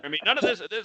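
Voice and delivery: ordinary talk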